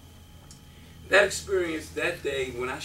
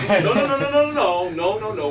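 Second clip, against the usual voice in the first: loud, high rapid-fire string of no's; flustered refusal; 6 semitones higher, 11 dB louder than usual. Retold or imitated. Coach stops recruit who would sign at once, loud mock-flustered refusal. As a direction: cut the kid off with urgent, rising protest.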